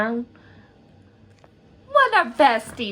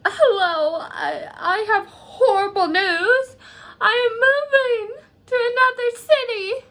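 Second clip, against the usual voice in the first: high mock-tearful wail; gasp, then wail the bad news; hammed-up distress; 10 semitones higher than usual. Friend phoning bestie about moving away, hammed-up distress. Character B phones with horrible news.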